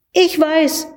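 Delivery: put-on high, eager boy's cry; aufgeregt. A narrator's reading. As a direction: blurt it out excitedly. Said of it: "rief der Junge aufgeregt". (aufgeregter hoher Ausruf)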